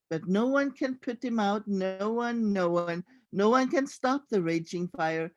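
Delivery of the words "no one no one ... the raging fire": usual speaking voice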